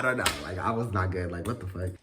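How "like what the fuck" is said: ordinary talk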